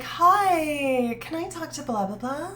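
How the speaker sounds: high, bright phone greeting; customer service voice. Retold or imitated. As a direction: put on the sweet customer service voice. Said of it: "customer service voice".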